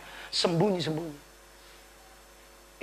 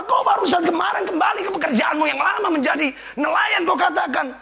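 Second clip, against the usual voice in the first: scolding shout; 11 semitones higher, 6 dB louder than usual. Acted. Preacher voices scolding Lord, not real.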